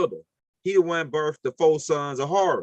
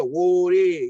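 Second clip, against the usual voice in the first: "Woody" called out a little louder, New Orleans style; New Orleans slang; 2 semitones higher, 4 dB louder than usual. Retold or imitated. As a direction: say "Woody" like back home. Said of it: "That's how we talk down here".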